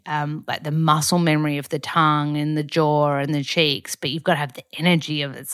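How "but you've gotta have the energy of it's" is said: ordinary talk, nothing marked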